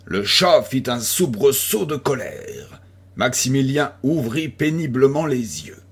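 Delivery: ordinary delivery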